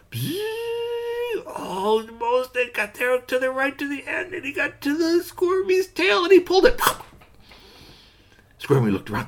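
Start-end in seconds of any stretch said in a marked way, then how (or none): 0.1-6.8 very high, slow stretching voice; squeaky high mechanical stretch; buzzing arm sound. Acted.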